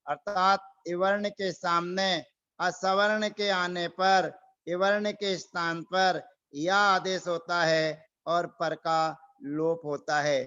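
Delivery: ordinary talk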